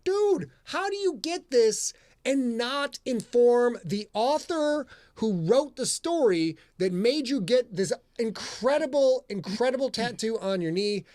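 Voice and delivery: high, slow, emphatic disbelief; astonished mock reproach. Author retells scolding a tattooed fan, astonished mock reproach. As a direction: draw it out high, stressing each phrase in amazement. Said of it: "And I'm like".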